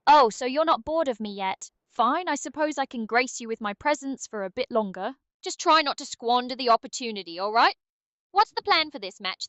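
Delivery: put-on haughty, teasing British accent; British Aria. British sidekick returning to grace the player, haughty teasing. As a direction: condescend playfully in a British accent. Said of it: "Can British Aria come back for a bit?".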